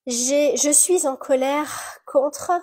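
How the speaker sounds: loud, slow declaration of anger; emphatic affirmation. Shown as a demonstration. Leading an anger tapping affirmation.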